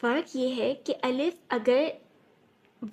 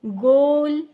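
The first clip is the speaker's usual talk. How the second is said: गोल called out loud with a long ओ; loud demo word; 2 semitones higher, 8 dB louder, much slower than usual. Shown as a demonstration. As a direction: project गोल to the class. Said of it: "वाव की आवाज़ ओ की है". (ज़ोर से गोल)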